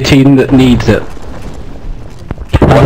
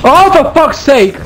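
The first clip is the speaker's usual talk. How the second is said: higher, exasperated yelling; frustrated outburst; 7 semitones higher than usual. Real, not acted. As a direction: blow up in frustration. Frustrated outburst during game.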